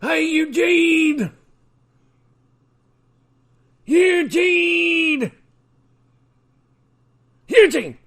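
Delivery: drawn-out yell to someone outside; hollering a name. Pretend. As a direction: bellow across the house for Eugene. Calling across the room.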